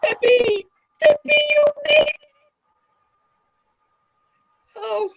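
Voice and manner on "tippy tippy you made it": high, fast overjoyed squeal of welcome; overjoyed cry. Acted. Mother overjoyed to see Tippy, squealing with joy. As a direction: greet the lost pet with delight. Mother greets a returned pet.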